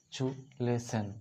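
চু lesson said slowly, syllable by syllable; slow syllable demo. Shown as a demonstration. Teacher slowly spelling out চু lesson for the class. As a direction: stretch out চু and lesson so kids hear each part. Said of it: "চু plus লে".

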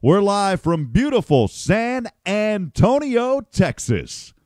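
high, drawn-out on-air station call; radio broadcast announcer. Shown as a demonstration. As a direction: announce it slowly like a live broadcast opener. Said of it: "I would always say".

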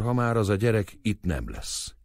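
ordinary delivery

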